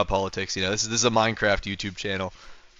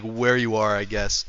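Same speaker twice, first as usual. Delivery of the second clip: ordinary talk, nothing marked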